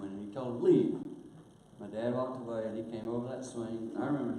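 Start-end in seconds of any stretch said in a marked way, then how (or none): 0.6-1.0 loud, high one-word command; firm loud dismissal; stern order. Retold or imitated. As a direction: bark it at the dad. Grandpa ordering dad out.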